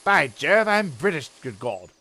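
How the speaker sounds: lightly hammed British colonel; a hint of a blustering officer; pompous sputtering colonel. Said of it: "I am British".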